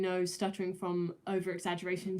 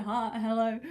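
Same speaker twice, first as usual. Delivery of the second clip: casual greeting; 3 semitones higher, 5 dB louder, slower than usual. Retold or imitated. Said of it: "just saying".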